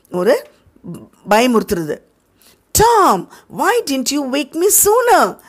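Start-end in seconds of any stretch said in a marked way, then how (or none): 2.8-5.4 put-on high, worried boy's voice; worried Sid. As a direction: ask why he wasn't woken. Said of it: "சித்து சொல்றாங்க". (சித்துவின் கவலையான உயர்ந்த குரல்)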